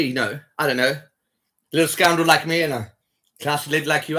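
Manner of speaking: normal talking voice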